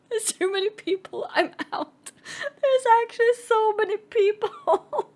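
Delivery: high, laughing through the words; nervous laughter, near tears; laughing panic. Real, not acted. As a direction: giggle helplessly at the crowd. Panicked escape retold.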